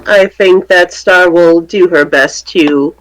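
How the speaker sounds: ordinary delivery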